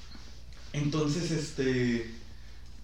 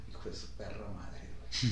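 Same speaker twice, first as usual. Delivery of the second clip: muttered curse; 8 semitones lower, 11 dB quieter than usual. Retold or imitated. Inner curse at the robber.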